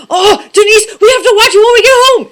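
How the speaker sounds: high, loud excited squeal; retold freak-out. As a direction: freak out loudly in a rush, retold. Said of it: "had a freak out in the aisle".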